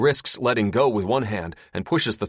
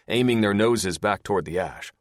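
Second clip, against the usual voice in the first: plain reading voice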